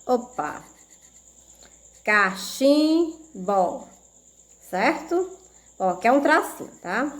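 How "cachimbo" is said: "Cachimbo" slowly as CA-CHIM-BO, short breaks; syllable by syllable. Shown as a demonstration. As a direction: draw out each syllable of "Cachimbo". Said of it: "como é que ela fica separada".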